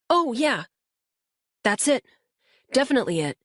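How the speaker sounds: put-on a kid pointing out the house, keen; Luis, excited boy. A narrator's reading. Boy in the back seat guiding the officer, eager.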